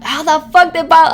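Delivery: high, loud disbelieving cry; imitating stunned people; amazed outburst. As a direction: blurt it out in shock. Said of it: "they're like".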